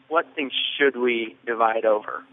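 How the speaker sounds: ordinary delivery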